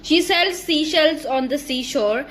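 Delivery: slow, each s and sh kept apart in "seashells"; slow careful twister. Shown as a demonstration. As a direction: read "She sells seashells" slowly and cleanly. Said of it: "मैं shells की जगह sales कह चुकी हूँ". (धीरे और साफ़ "She sells seashells")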